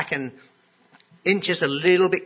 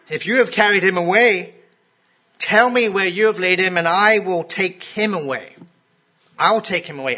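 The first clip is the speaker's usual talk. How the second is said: raised, urgent plea from a grieving woman; pleading; 5 semitones higher, 6 dB louder than usual. Retold or imitated. Mary begs the presumed gardener for Jesus's body, urgently raised. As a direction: beg to know where he is.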